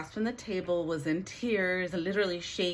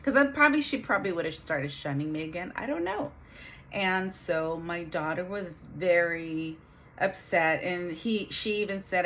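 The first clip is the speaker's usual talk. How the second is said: ordinary delivery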